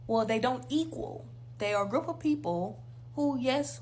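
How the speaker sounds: ordinary delivery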